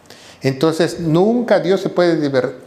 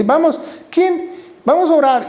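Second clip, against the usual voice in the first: much higher, sing-song rallying call; mock call to prayer; 10 semitones higher than usual. Preacher imitating a leader rounding up the church to pray, high and sing-song. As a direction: rouse the room like an eager prayer leader.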